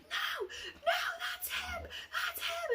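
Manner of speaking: very high, strained squeal of panic; desperate warning cry. Retold or imitated. Girl warning the others it's him, panicked squeal. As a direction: re-enact a frantic high-pitched plea.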